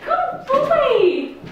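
high laughing praise; squeaky praising a dog. Real, not acted.

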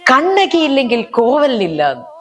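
slow, high, crying stage wail; tearful stage line. Retold or imitated. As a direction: deliver the tragic line through tears. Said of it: "വികാരഭരിതയായിട്ട് ഞാൻ പറയണം".

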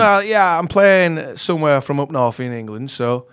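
put-on Northern England accent; northern English accent. Shown as a demonstration. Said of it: "just do the accent".

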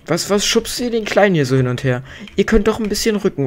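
high-pitched, indignant telling-off; indignant scolding. Real, not acted. Player telling off villagers for shoving the little one, indignant.

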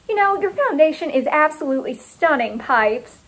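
sugary gushing; gushing compliment. A narrator's reading. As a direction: lay on the praise for a friend.

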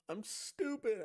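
high put-on silly voice; high mocking goofy voice; mock dumb voice. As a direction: play the fool in a squeaky tone. Mock self-deprecating voice.

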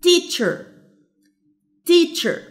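teacher with TEACH high, then falling; stress on first syllable. Shown as a demonstration. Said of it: "هو ده الستريسد syllable".

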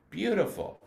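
Beautiful said clearly as an embouchure demo; demo word. Shown as a demonstration. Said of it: "ask them to say the word beautiful".

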